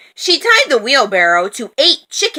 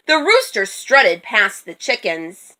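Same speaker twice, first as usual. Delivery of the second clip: ordinary delivery, nothing marked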